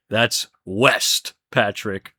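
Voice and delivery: lightly voiced cartoon character, low; a low, mildly put-on cartoon voice; cartoon quote. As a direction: lightly imitate a cartoon character correcting a friend. Quoting cartoon dialogue.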